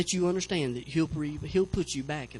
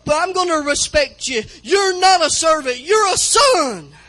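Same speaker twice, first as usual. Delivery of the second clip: loud, high, building preacher's cry; preaching at full force; 12 semitones higher, 16 dB louder than usual. Acted. Pastor rallying the congregation to welcome prodigals, full-force preaching. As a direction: lift the church with every line, shouting the Father's welcome.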